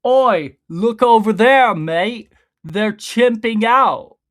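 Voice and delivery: high, loud mock British accent, cockney oi. Imagining Chud as British, loud mock British accent. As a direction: shout it like a rowdy British lad, slow on the last line. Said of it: "I wish Chud would have been British".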